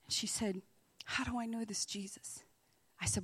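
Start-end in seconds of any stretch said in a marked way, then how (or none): 1.1-2.4 hushed, tearful whisper; a soft, awed whisper asking how to find Jesus; whispered question. Retold or imitated. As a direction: whisper it as if moved to tears. Retelling the woman's tearful question.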